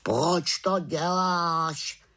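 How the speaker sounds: high, slow drawn-out raven question. A narrator's reading. Raven questioning the witch's prank, drawn out high.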